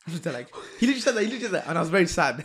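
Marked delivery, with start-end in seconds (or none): 0.8-1.7 excited disbelief. Real, not acted. Disbelief retelling lecturer's words. 1.7-2.4 laughing through "and I was very sad", louder and higher; can't get the words out for laughing. Real, not acted. Repeats quote while laughing.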